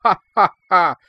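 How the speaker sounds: loud, high, open-throated laughter; big round laugh. A narrator's reading. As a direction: throw the head back and laugh fully. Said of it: "and laughed, big and round".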